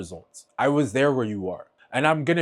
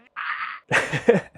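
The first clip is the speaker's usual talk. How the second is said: teary breathy laugh; 7 semitones higher than usual. Real, not acted. Laughs through tears.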